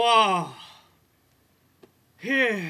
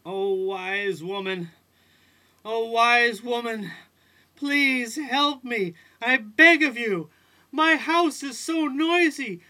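louder, higher pleading to the wise woman; desperate begging; 4 semitones higher, 4 dB louder than usual. Acted. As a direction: beg on your knees for help. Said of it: "dragged himself crawling to the house".

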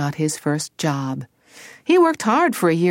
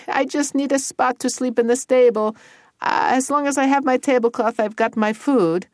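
higher-pitched simple lad; pitched-up naive storybook voice; Tony declining; 7 semitones higher, 3 dB louder than usual. Acted. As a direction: politely turn down the invitation.

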